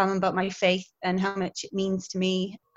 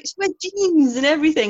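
higher, louder retold argument with a laugh; amused insistence; 7 semitones higher, 7 dB louder than usual. Teacher convincing schoolchildren it's acting, amused insistence. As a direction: state it as obvious proof, amused. Sister explains to children.

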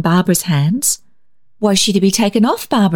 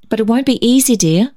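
ordinary delivery, nothing marked

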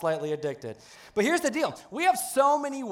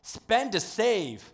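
slow drawn-out; 4 semitones higher, much slower than usual. Shown as a demonstration. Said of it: "how about this one".

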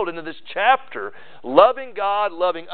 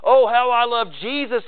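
higher, hammed-up Sunday piety; mock pious gushing; 7 semitones higher than usual. Preacher mocking a hypocrite's Sunday praise, hammed-up gushing. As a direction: gush it like a showy churchgoer. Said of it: "It means you can't say".

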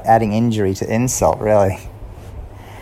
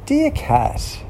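higher voice reading the card; reading Santa's card. A narrator's reading. Mouse reading Santa's card aloud, higher. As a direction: read out the greeting. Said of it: "It says".

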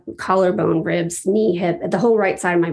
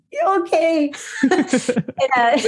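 laughing; 7 semitones higher, much slower than usual. Real, not acted. Shy reply to praise.

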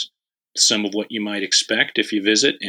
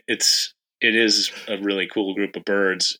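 normal talking voice

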